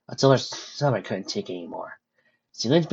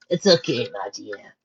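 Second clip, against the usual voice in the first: chuckling; slower than usual. Acted. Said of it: "chuckling all the while".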